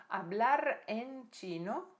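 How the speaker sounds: "Hablar en chino" bem devagar, marcando cada palavra; ênfase em hablar en chino. Shown as a demonstration. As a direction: destaque lento em "Hablar en chino". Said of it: "Olha a diferença aqui entre português e espanhol". (expressão em destaque, devagar)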